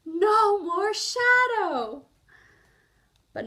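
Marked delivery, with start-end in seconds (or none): 0.0-2.1 high, loud and slow triumph; relieved Bear. Acted. Bear relieved his shadow is gone, slow. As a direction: savour it, drawn out and glad. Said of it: "sighed Bear".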